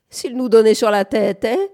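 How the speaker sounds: put-on sarcastic old man. A narrator's reading. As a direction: needle him as a sceptical old man. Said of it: "dit le vieux sarcastique".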